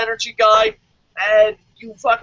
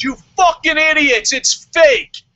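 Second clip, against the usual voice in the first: loud, higher mock outburst at the crowd; angry magician; 7 semitones higher, 5 dB louder than usual. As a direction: yell it like a fed-up magician. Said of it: "magic trick and goes".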